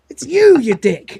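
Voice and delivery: high, loud jump on YOU; exasperated retort. Retold or imitated. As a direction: burst out at an idiot, mock-exasperated. Lister exasperated at his recorded self.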